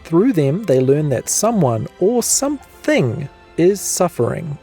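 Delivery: normal talking voice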